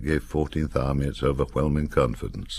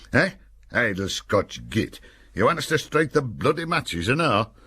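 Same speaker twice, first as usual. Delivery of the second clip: mock indignant soldier; 8 semitones higher than usual. A narrator's reading. Soldier sneering at Jock.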